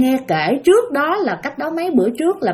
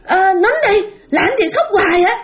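put-on angry kid scolding; 9 semitones higher than usual. Little brother scolds the crying ghost, angry. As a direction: yell at the crying ghost to shut up. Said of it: "tức lên".

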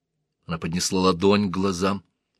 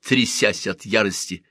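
ordinary delivery

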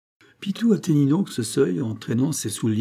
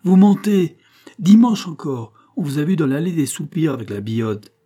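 loud, high accusation easing slightly; acted scolding outburst; growing anger; 6 semitones higher, 7 dB louder than usual. A narrator's reading. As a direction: hurl the charge angrily. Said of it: "dont la colère s'augmentait".

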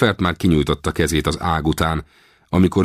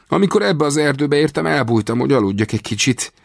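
higher-pitched agitated confession, pitch swinging upward; captive recounting his escape; 8 semitones higher than usual. A narrator's reading. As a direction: plead your story as the captured man, high and urgent.

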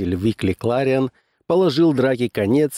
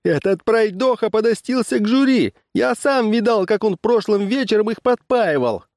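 very high-pitched complaint; aggrieved accuser; 13 semitones higher than usual. A narrator's reading. Farmer accusing rival over blue ribbon, aggrieved. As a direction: protest in a high, indignant voice.

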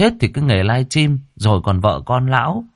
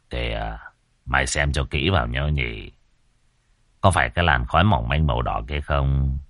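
low, soft master's voice; old master, knowing; 7 semitones lower, 5 dB quieter than usual. A narrator's reading. Old master pointing out red smoke to his disciple, low and knowing. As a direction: drop low and quiet, like an old teacher.

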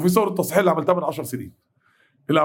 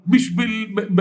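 ordinary talk, nothing marked

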